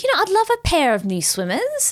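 high, wistful mum voice; imitating mum. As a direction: mum dropping a hint. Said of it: "mum's like".

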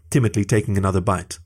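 ordinary delivery

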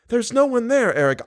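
very high, urgent denial; pleading girl. Acted. Girl denies anyone is in the chamber, pleading. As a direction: insist in a high girl's voice.

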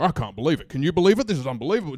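mock grumbling of indignant passengers; pissed-off travellers. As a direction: complain in disbelief, lightly acted. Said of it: "this sea of pissed off Australians".

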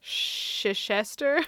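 Chichester as "shh-shuh-SHESS-ter", drawn out; slow Chichester with sh sounds, rising; trying pronunciations. Shown as a demonstration. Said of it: "Is it".

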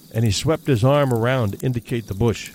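plain reading voice

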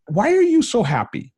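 high-pitched, incredulous retold question; mock bewilderment; baffled question. Retells baffled question to giddy doctor.

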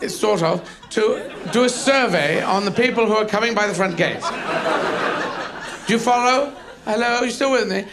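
high, questioning, politely doubtful dog; hesitant dog. Acted. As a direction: explain yourself as an unsure guard dog. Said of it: "unsure dog going".

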